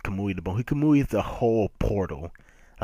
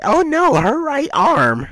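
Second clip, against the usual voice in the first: very high, loud mock panic; 10 dB louder, slower than usual. Villain freaks out over his mother's arm, panicked wail. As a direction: wail it in hammed-up horror. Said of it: "he's freaking out like".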